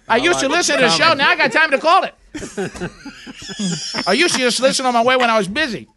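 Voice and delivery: mimicking Tommy's accent. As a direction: copy the caller Tommy's accent, half-teasing. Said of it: "Y'all wish y'all had his accent?".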